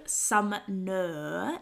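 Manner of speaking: "Sumner" broken slowly into SUM-NUH; pointed pronunciation. Shown as a demonstration. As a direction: sound out "Sumner" with exaggerated care. Callback to the pronunciation argument.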